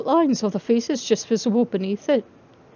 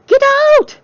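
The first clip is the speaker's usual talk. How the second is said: much higher, loud startled yell; shouting; 11 dB louder than usual. Acted. Charlie yelling at John peering through her door, shrill shout. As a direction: yell him out of the room. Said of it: "she cried".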